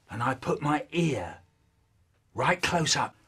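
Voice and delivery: ordinary talk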